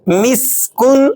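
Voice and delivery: مس with a thin, hissing س; whistled sin. Shown as a demonstration. Teacher showing the whistling س in مسکن. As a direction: let the س whistle fine. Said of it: "سین کو آپ نے باریک سیٹی کے ساتھ".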